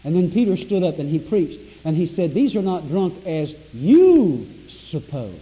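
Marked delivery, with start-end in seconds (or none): 2.3-5.3 "you" stretched long and punched loud; stress on YOU. Shown as a demonstration. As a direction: lean hard and slow on "you". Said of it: "I put a little emphasis there".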